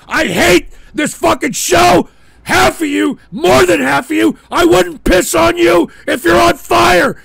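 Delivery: loud, high, real hatred in the yell; enraged shouting. Real, not acted. Host telling viewers he hates them, shouting. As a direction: spit every word at them.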